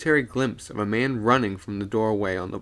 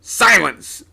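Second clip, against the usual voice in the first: loud, higher commanding shout; captain silencing the crowd with a shout; 11 semitones higher, 11 dB louder than usual. A narrator's reading. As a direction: cut them off sharply. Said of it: "shouted the old man".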